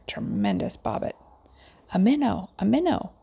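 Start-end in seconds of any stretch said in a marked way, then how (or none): 1.9-2.5 eager cry at the first bite, a touch louder; excited cry. Acted. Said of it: "cried Mister Jeremy Fisher". 2.6-3.1 same cry pushed much higher, rising at the end; higher excited cry. Acted. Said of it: "cried Mister Jeremy Fisher".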